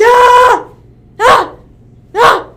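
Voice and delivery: high, loud imitation cartoon scream; mock-panicked cartoon yells; Patrick's screaming. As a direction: belt out one long scream, then two short. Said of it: "Patrick keeps screaming".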